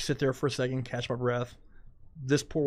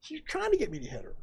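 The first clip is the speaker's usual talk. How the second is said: much higher, insistent retelling to the cop; indignant protest. Retold or imitated. Driver protesting to the deputy, much higher indignant voice. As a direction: plead your case, pitch way up. Pleading his case to officer.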